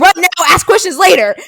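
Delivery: loud, high mock command; retold shouted order. Acting out strangers who ran too, shouted advice. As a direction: shout it as an imitated urgent order. Acts out the group's blunt reply.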